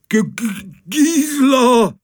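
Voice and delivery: high, halting stammer; stammering captain. Acted. Stunned captain stutters her name, high. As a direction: stumble over her name.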